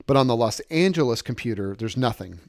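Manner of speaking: normal talking voice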